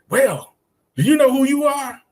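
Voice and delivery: high-pitched mock challenge; imitating frustrated students. Students challenging the teacher after failing to answer, mock frustration. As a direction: fire it back like an exasperated student. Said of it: "they get all frustrated".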